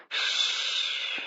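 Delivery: breathy, voiceless hush against her lips; soft voiceless shh; murmured shh. A narrator's reading. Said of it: "he murmured against her mouth".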